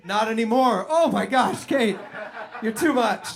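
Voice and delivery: high, loud giddy swoon; loud, giddy swooning over Kate. Retold or imitated. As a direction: gush like a lovestruck teen. Reliving the hottest moment, swooning.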